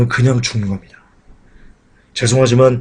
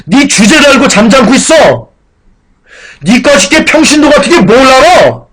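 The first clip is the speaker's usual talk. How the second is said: higher, loud belittling put-down; scornful church elder; 11 semitones higher, 5 dB louder than usual. Acted. Church officers silencing a layman, scornful. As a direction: put the layman in his place. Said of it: "이렇게 얘기하는 겁니다".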